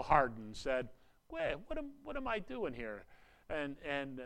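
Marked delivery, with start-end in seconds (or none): 1.3-3.0 a higher, softer voice for Pharaoh, puzzled; Pharaoh's second thoughts. Retold or imitated. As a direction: Pharaoh suddenly wondering what he's done, pitched up.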